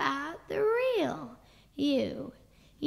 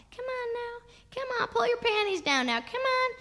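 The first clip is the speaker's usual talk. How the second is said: high, wheedling urging; coaxing; 6 semitones higher than usual. Retold or imitated. Father urges reluctant child, high coaxing. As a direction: coax with a lifted voice.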